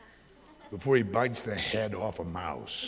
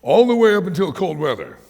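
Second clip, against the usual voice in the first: ordinary talk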